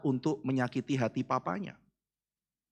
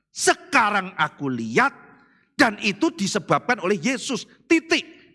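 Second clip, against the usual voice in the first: loud, high preaching cry; full-force preaching; 7 semitones higher, 4 dB louder than usual. Acted. Preacher stirring congregation, loud high preaching. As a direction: preach it hard to the crowd.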